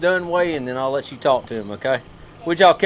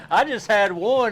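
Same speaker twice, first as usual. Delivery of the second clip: laughing; 6 semitones higher than usual. Real, not acted. Laughing about eating burgers.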